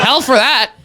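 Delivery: ordinary talk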